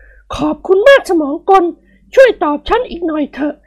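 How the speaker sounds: high woman's voice, pressing. Acted. Wife asking the machine about the singer, high voice.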